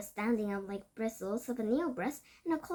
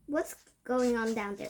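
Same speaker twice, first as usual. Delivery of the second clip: plain reading voice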